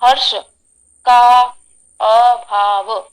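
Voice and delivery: one word at a time with long gaps; words cut apart by long pauses, last one slow; broken halting voice. Shown as a demonstration. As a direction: break the phrase into separate words, pausing between each. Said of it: "जैसे कि अभी बोल के बताऊं".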